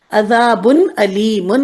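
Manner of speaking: عذاب علیم slow, with hamza in علیم; careful recitation. Shown as a demonstration. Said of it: "دردناک". (حمزہ والا علیم آہستہ)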